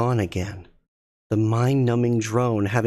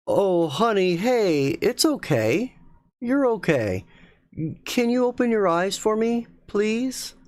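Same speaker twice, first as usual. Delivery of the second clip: higher tender coaxing voice; soothing; 10 semitones higher than usual. Acted. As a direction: coax her gently back to calm. Husband soothing sobbing wife.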